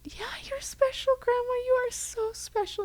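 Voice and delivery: soothing high voice to grandma; reassuring. Retold or imitated. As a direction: coo it gently to a frail grandma. Family reassuring dying grandma.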